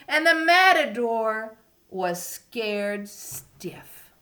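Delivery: bold storybook narration; reading to a toddler. A narrator's reading. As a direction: make it grand for the kids. Reading story to young children.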